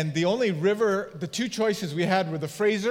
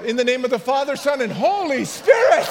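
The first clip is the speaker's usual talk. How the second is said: higher, louder, hurried shout of the blessing; 5 semitones higher, 5 dB louder than usual. Retold or imitated. Pastor calling the blessing after a woman swept downriver: loud shout. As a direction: holler it as she drifts away. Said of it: "This is how it went".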